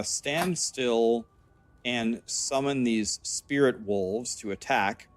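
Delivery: slow, deliberate read of the text; slow reading aloud. Acted. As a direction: read each word out carefully.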